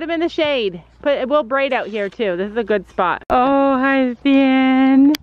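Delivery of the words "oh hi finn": higher, louder fond hello to the horse; cooing to horse. Real, not acted. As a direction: greet him like a beloved pet. Greeting her horse on arrival.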